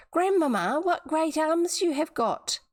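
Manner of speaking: put-on little girl voice. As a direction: sound small and amazed. Girl's voice, higher.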